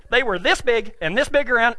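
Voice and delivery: normal talking voice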